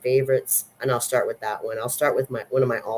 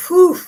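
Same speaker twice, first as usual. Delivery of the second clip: loud, high exhaled whew; awed whew; 11 dB louder than usual. Real, not acted. Film fan reacting to an intense monologue, awed whew. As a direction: blow out an impressed whew.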